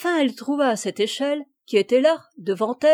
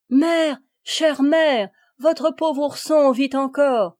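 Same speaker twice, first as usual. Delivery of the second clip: loud, higher call from below; 5 semitones higher, 7 dB louder, slower than usual. A narrator's reading. Ourson calling up to his mother from the well, loud. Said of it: "la voix de son cher ourson qui appelait".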